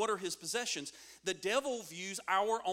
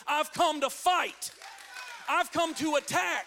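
raised declaration building to a shout; full-force preaching; 4 semitones higher, 4 dB louder than usual. Acted. Jesus serving notice on Satan, forceful and building to a shout. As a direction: challenge the enemy, louder with every phrase.

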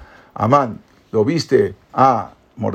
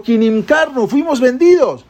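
high and louder insistence; insistent; 11 semitones higher, 4 dB louder than usual. Acted. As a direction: press the point home, rising up. Esther insists to the king.

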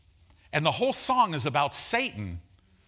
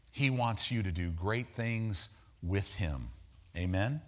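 ordinary talk

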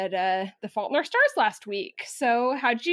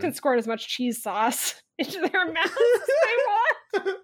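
laughing; 8 semitones higher than usual. Real, not acted. Joking about wizard cheese sauce.

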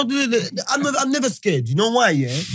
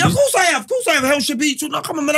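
indignant protest; 7 semitones higher, faster than usual. Real, not acted. Defending that he has been.

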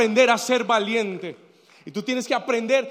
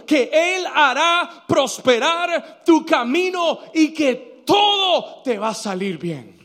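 slow emphatic repeats climbing louder and higher, then dropping; building preaching; 3 semitones higher, 5 dB louder, slower than usual. Real, not acted. Preacher promising God will prosper your way, building fervour.

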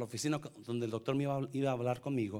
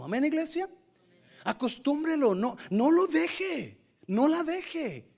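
higher, louder exhortation to the church; fiery preaching; 7 semitones higher, 4 dB louder than usual. Acted. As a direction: push the congregation, full-voiced. Preaching at full force.

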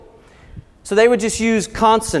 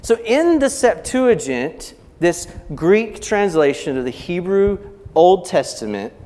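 normal talking voice

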